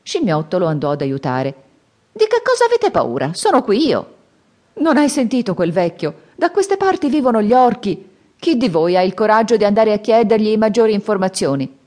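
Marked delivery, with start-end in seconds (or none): 2.1-4.1 high, loud and sure of himself; cocky Monkey. Acted. Monkey reassures the fallen master. 4.7-11.8 higher, anxious monk; fretful master. Acted. Frightened Tripitaka asks.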